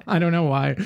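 ordinary talk, nothing marked

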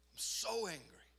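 'so' leaps up high, held back in volume; retold anger outburst. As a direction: confess anger with a pained push on 'so'. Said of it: "until I was like".

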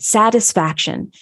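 usual speaking voice

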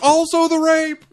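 a hammed-up heckler's shout; mock yell. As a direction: bellow it out like a heckler. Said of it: "he was like".

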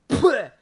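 sharp high imitated spit; a quick, high mock spitting sound; spitting noise. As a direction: spit the teeth into your hand with a burst. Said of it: "And then I just went".

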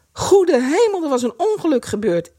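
high-pitched alarmed exclamation. A narrator's reading. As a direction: cry out in sudden alarm. Narrator voices alarm at accident.

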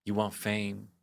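understated dramatic challenge; quietly theatrical teacher's speech; monologue delivery. Retold or imitated. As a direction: deliver it as a measured monologue line. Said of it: "I was giving it".

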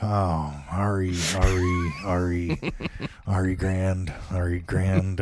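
muttering to himself. Real, not acted. Muttering while typing a search.

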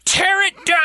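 high, loud mock rally cry; mock protest shout. Parodying angry protesters while praising the documentary, loud mock rally shout. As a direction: hammer it out like a crowd chant.

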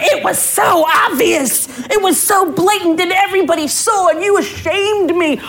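mock shrill accuser, stretched out; imitating her accusation. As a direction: play the offended woman over the top. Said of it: "She's like".

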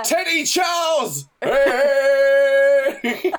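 loud, high showman introduction; stage announcer. Pretend. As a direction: announce him like a star.

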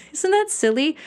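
high, laughing question; amused, self-mocking laugh; laughing at herself. Real, not acted. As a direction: giggle through it at your own past. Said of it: "I say it's silly".

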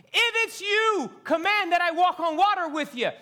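high, loud call across the water; Peter calling out. Acted. Peter calling to Jesus from the storm-tossed boat, pleading loudly.

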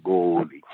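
"Goalie" with a long, low stretched O; drawn-out nickname. Retold or imitated. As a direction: imitate the boss drawling "Goalie" as "Goooalie". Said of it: "he said like that".

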